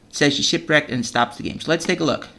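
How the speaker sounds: ordinary delivery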